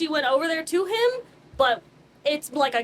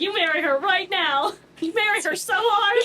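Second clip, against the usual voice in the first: mock order; 7 semitones higher, 4 dB louder than usual. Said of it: "He said".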